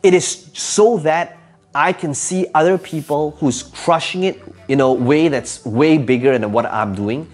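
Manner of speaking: ordinary talk, nothing marked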